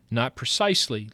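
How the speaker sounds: ordinary talk, nothing marked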